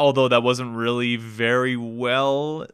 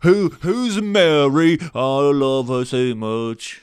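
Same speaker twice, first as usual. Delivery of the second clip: bad Cockney impression; 3 semitones higher, 5 dB louder, slower than usual. Shown as a demonstration. Mocking the actor's famous accent, exaggerated bad Cockney. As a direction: swallow the words in a terrible Cockney accent. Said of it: "That's me doing a bad impression of his".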